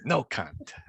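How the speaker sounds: playful imitation of a strict host; [name]. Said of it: "that act the drill instructor mode".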